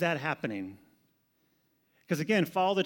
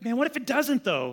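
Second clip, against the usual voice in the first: worried outburst; 9 semitones higher, 4 dB louder than usual. Retold or imitated. Pastor retelling his own worry to Jaime, anxious high pitch. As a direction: pitch jumps high and loud on man. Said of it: "I kinda get upset at it".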